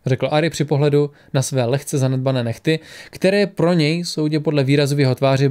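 ordinary delivery

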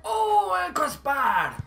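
high drawn-out cry falling away; strained exclamation. Real, not acted. Player watching own shot miss, high agonised cry. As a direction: wail it high as it misses.

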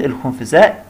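normal talking voice

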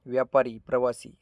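ordinary delivery, nothing marked

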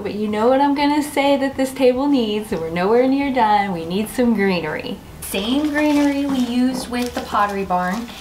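ordinary delivery

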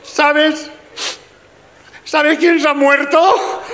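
very high, slow mock-weeping wife; mujer llorando. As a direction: wail it slowly as a tearful wife. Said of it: "la que está llorando es la mujer". (esposa llorosa, aguda y exagerada)

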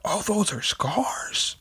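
breathless whisper; stunned, hushed whisper; whispered shock. Real, not acted. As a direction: gasp it out under the breath. Shocked aside while reading.

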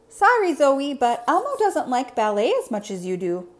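high, loud little monster voice; Elmo apologizing. Acted. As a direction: apologize in a lively, high character voice.

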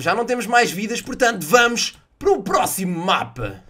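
normal talking voice

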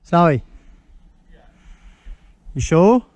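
ordinary delivery

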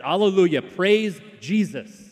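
high, loud Alleluia, slow drawn-out praise Jesus; fervent praise. Retold or imitated. Woman in a Presbyterian pew, loud fervent praise. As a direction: stand and shout your praise. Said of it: "stood and said".

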